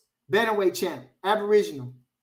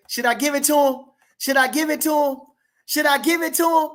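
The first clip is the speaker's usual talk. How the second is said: higher and louder each time, playful taunt; teasing tease; 9 semitones higher, 5 dB louder than usual. Real, not acted. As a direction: dangle it in front of the haters. Teasing chat, pitch climbing.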